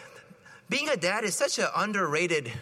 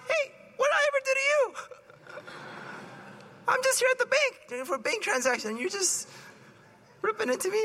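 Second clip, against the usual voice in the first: much higher, fast, mock-indignant squeal; indignant protest. Priest protesting to the yelling stranger, high and indignant.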